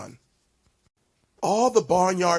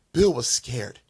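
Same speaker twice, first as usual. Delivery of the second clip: plain reading voice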